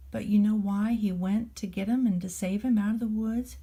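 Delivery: ordinary delivery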